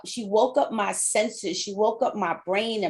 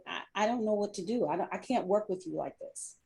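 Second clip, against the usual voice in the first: hushed, much softer, near tears; retold tearful plea; 8 dB quieter, faster than usual. Coworker Lisa telling her boss she can't go on, hushed and tearful. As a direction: soften right down as if about to cry. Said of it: "she started crying".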